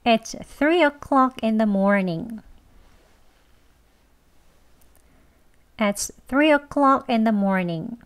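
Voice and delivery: very slow "It's three o'clock in the morning", each word clear; slow careful English. Shown as a demonstration. Teacher reading the second example slowly. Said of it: "천천히 두 번 합니다".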